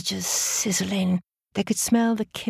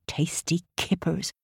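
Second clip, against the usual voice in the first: ordinary delivery, nothing marked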